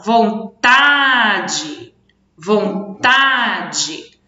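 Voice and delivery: vontade stretched slowly, long strong TA; slow teaching demo of vontade, TA held; stress on middle syllable. Shown as a demonstration. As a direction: draw out the TA in vontade. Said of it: "o mais forte tá no tá".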